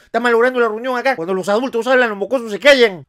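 regaño agudo y fuerte; riñe como un tío harto de los mocosos; tío amargado. Acted. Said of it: "mi tío el cachirulo se amarga". (imitación enojada de un tío regañón)

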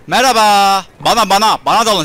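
cocky loud challenge to the attackers; taunting battle shout. Acted. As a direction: call them to charge you.

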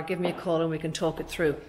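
ordinary talk

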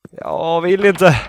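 loud, high, drawn-out whine; slow wail of refusal; reluctant whine. Real, not acted. Player dreads continuing the horror game, whining protest.

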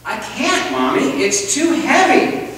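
put-on high, eager child calling up from the basement; little boy's voice. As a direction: sound like a small kid begging mom to come. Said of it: "this little voice".

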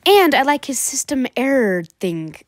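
ordinary talk, nothing marked

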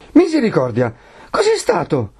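high-pitched startled outcry; Perpetua's alarmed cry. A narrator's reading. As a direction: exclaim in alarm, voice jumping up. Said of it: "gridò Perpetua e volle correre". (grido allarmato, acuto)

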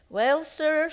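loud, high call over the wind; shouted. A narrator's reading. Driver shouting to the master over the blizzard. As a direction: shout it into the storm. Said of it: "shouted the driver".

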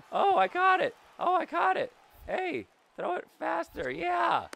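high, loud yell of delight; thrilled shout. Real, not acted. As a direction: celebrate the catch. Celebrating a catch.

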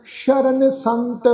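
drawn-out, syllable by syllable scripture reading; slow recitation. Shown as a demonstration. Teacher reciting the hymn slowly for the congregation. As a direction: lay each syllable down slowly and reverently. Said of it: "ਉਚਾਰਨ ਕਰਨਾ ਵਾ ਜੀ".

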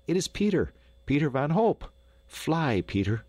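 high-pitched thrilled recognition; urging on a racer; excited cheering. A narrator's reading. Narrator cheers on the race leader.

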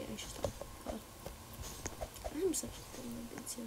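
ordinary delivery

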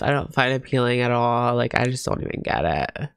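ordinary delivery

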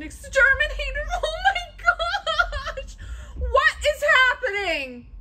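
high, tearful wail of panic; panicked high cry; screaming in fright. Real, not acted. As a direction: wail it high in real fear. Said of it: "hear me scream like this".

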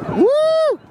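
high loud woo; whoop. Real, not acted. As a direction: whoop at the fireworks. Celebrating fireworks.

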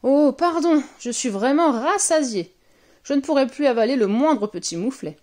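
big contented ogre voice; sated ogre. A narrator's reading. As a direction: excuse yourself, full to bursting. Ogre excuses burp, full up.

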